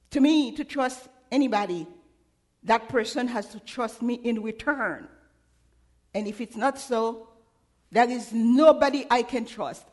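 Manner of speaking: normal talking voice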